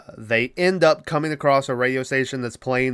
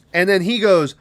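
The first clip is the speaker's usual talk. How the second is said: ordinary talk, nothing marked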